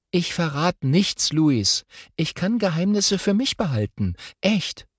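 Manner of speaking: a schoolgirl rattling on about art class; chatty girl voice. Acted. Girl chattering to her twin on the way to school, lively. Lotta promising secrecy.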